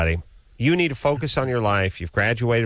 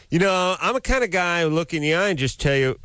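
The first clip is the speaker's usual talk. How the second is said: blowhard voice; 8 semitones higher than usual. Retold or imitated. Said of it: "I like this blowhard".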